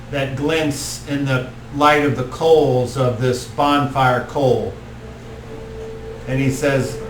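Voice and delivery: ordinary delivery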